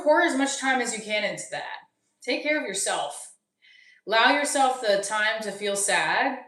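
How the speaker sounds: normal talking voice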